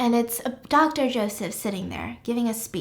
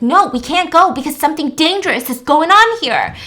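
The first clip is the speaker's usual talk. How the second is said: raised, high, loud refusal; yelling; 6 semitones higher, 10 dB louder than usual. Retold or imitated. Will refuses to go with Kira, yelling in alarm. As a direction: yell it over their attempts to leave. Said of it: "he's just yelling".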